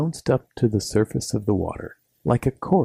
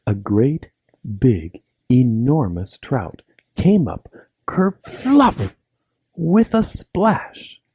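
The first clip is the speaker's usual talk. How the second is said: louder, slow, rising with each word; dramatic build; 4 semitones higher, 4 dB louder, slower than usual. A narrator's reading. Narrator telling the child about the huge trout, slow and louder. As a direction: build up the monster trout slowly.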